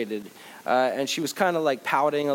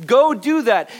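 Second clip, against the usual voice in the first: much higher, louder barked order; mock command; 10 semitones higher, 10 dB louder, slower than usual. Preacher's pretend Jesus ordering disciples out, loud. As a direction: send them off with a big shout.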